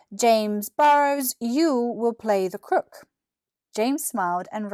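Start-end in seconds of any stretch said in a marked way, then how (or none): 0.1-3.1 slow, drawn-out teacher announcement. Acted. Miss Willis announcing James's part, slow and formal. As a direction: savour each word of the casting.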